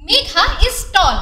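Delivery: very slow, word by word example sentence; slow reading aloud. Shown as a demonstration. Teacher reading an example sentence to pupils, very slow. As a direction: spell it out slowly for the class. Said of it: "চলো তোমরা প্রথমে এই তিনটি বাক্য পড়ো তো".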